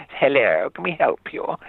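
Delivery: high, plummy imitation of a grand actress; hammed-up plummy English lady; posh actress voice. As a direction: ham up a very posh lady opening the door. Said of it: "I put on this posh voice, sort of mimicking her".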